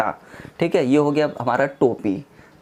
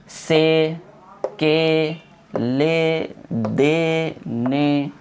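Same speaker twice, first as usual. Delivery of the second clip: से, के, ले with long level vowels; drawn out; much slower than usual. Shown as a demonstration. Teacher showing students the long e, each syllable stretched. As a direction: hold the e in से, के, ले, दे, ने. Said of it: "या के साथ पढ़ेंगे खींच के".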